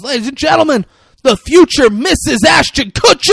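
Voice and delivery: high, booming ringmaster shout; big announcement. Retold or imitated. Florist presenting his fiancée to the whole store, shouted announcement. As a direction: announce it like a ring announcer, stretching the last name. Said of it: "he announces to the whole story".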